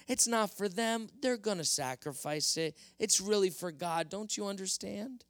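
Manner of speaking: king wheedling his way out of blame; insisting Saul. Acted. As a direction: insist you did nothing wrong. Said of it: "So Saul insisted".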